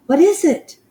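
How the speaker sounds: high, loud alarmed call; crying out. Acted. The witches answer her, crying out high and loud. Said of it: "the others cried out".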